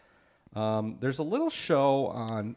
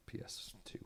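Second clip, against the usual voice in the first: whispered aside; 18 dB quieter than usual. Retold or imitated. Whispered punchline of retold gift.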